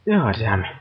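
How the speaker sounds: normal talking voice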